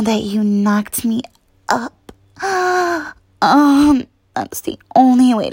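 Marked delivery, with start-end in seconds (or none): 2.4-3.1 high, needy gasp; whimpering gasp. Pretend. Lover caught mid-plea, gasping high. Gasp within erotic roleplay.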